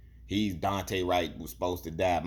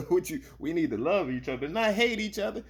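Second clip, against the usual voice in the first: mocking impression; 11 semitones higher than usual. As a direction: imitate him, high and preachy.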